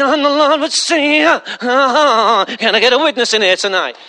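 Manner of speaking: mock showy preacher. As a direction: ham up the anointed stage preacher. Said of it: "if you put a funny voice on it".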